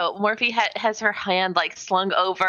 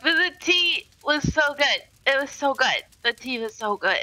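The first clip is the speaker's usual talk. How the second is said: put-on higher, sloppy drunk praise; drunk gushing; 7 semitones higher than usual. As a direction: gush like a tipsy guest getting sleepy. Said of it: "I'll be like".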